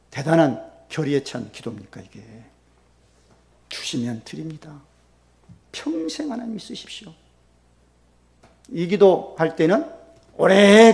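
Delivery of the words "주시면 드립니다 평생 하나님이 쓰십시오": quiet vow. Retold or imitated. Hannah's quiet resolved vow.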